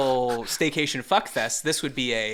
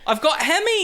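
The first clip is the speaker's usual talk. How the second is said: "hemis" said high and loud in a hammed-up Aussie accent; a broad Australian complaint, "I've got hemis"; mock Australian; 12 semitones higher, 4 dB louder than usual. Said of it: "how would an Australian pronounce".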